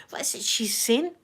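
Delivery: imitating confused onlookers under the breath; whispered mock bafflement. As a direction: whisper it like a puzzled bystander.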